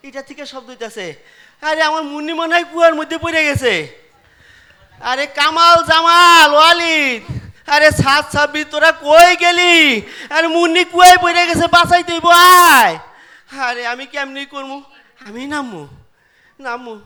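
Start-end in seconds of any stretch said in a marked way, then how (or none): 5.1-12.9 loud desperate calls to his sons; calling out. Acted. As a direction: yell for help across the house. Father calling sons to save Munni. (ডাকাডাকি করে জোরে চিৎকার)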